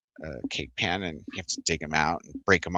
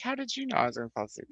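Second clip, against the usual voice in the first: mock surprise; 5 dB quieter, faster than usual. Retelling her own question to Jane.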